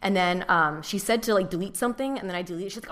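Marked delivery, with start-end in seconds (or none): none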